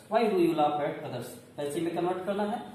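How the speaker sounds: ordinary delivery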